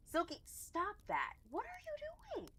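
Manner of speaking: sing-song high voice scolding the cat; high pet-talk scold. Real, not acted. Talking to her cat Silky, high sing-song scold.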